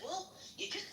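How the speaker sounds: high, hedging imitation; mock hesitancy. Sergeant asked why she seems mad, high mock hesitancy.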